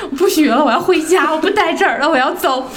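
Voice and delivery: high, laughing mock wail of quitting; whiny tearful protest. Diving student to coach, laughing mock wail. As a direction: play the upset learner begging to go home, half laughing. Retold crying, wanting to quit.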